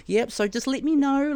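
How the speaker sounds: high, bright-pitched mimicked nurse; breezy high-pitched nurse voice; perky nurse. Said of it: "she's like ... like, real".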